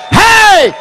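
high, loud joyful cry; triumphant shout. Acted. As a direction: yell out in triumph. Exultant outcry at the miracle.

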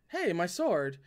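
ordinary delivery

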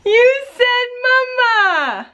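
high, drawn-out delighted exclamations; amazed excitement. Real, not acted. Mother amazed her child just spoke, excited. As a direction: gush in real amazement.

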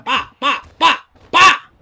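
"pa" hit after hit, each one punchier; imitated punches. Gamer voicing each blow landed on the opponent's head, imitated punches.

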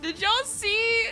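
normal talking voice

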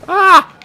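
sudden high loud yelp; startled yelp. Real, not acted. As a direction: yelp as it spins out. Car spins out.

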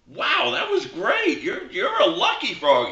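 amazed golfer. Acted. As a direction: gush with surprise at the shot. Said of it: "He looks at the frog and goes".

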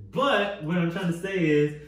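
ordinary talk, nothing marked

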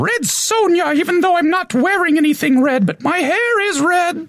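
high falsetto warrior woman; falsetto lady voice. Pretend. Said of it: "commanding type voice".

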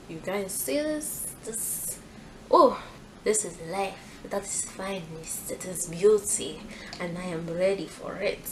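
put-on a clumsy French accent that slips toward African; mock French accent. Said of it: "a French accent".

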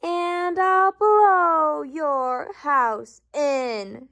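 slow, building storybook bellow; shouting wolf. A narrator's reading. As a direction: stretch each word out like a big storybook wolf. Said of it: "shouted the wolf".

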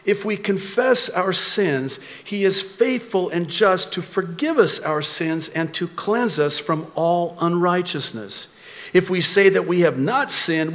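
loud, high, driving sermon delivery; full-force preaching. Acted. Preacher rousing the congregation with scripture, loud and driving. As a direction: preach it out to the whole room, rising.

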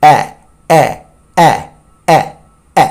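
এ repeated as an open a vowel; এ drilled like the a in cat; middle sound drill. Shown as a demonstration. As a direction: model the vowel sound, spaced out. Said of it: "middle sound হচ্ছে এ".

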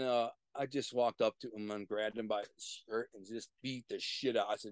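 ordinary talk, nothing marked

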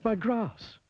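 high-pitched cry of dismay; acted storybook wail of alarm. A narrator's reading. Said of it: "cried Mister Fussy".

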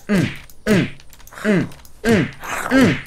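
high, loud effort grunts; punching grunts. Pretend. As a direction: grunt harder as the fight builds. Throwing punches in the game.

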